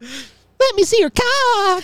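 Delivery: very high, slow squeaky cartoon voice. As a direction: drag it out in a tiny cartoon squeak. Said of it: "Next time he does the voice".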